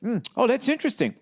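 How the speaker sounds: high, affected murmur; mock a fake-thoughtful taster; pretentious taster. Mocking customers pretending to savour ice cream. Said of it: "everybody's like".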